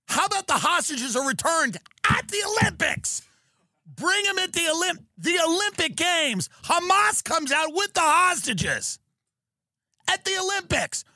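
high-pitched ranting shout; exasperated shouting. Real, not acted. Pitching a hostage return at the Games, exasperated high shouting. Comic rant pitching his idea.

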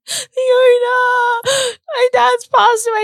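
very high, loud mock wail; drawn-out, high-pitched retold sob. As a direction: cry the news down the phone, hammed-up. Retold distressed call to therapist.

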